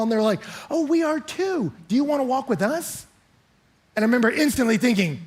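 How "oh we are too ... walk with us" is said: chatty couple. Retold or imitated. Couple inviting a stranger along, high and over-friendly. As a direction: mimic eager hikers, stretching the first words. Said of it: "they wanna visit".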